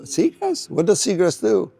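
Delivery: high, puzzled retold question; baffled surprise. Economist asked to value seagrass, retold bafflement. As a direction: pitch it way up, as if stumped. Retelling baffled reply to request.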